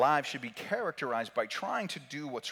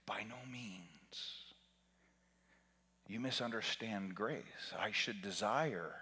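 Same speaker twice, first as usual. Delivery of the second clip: quiet; 6 semitones lower, 12 dB quieter than usual. Acted. Quiet confession.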